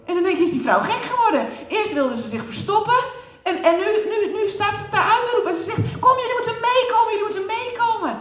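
high, laughing, excited telling; laughing excitement. Acted. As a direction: laugh through the telling with joy.

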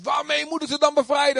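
high, bewildered question; pleading Moses. Retold or imitated. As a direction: ask it like a baffled Moses. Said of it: "dan zegt God". (hoog en verbaasd, als Mozes)

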